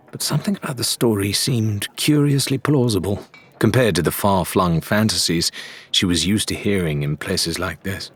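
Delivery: ordinary delivery, nothing marked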